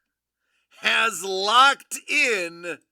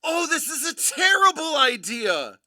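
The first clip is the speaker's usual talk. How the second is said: normal talking voice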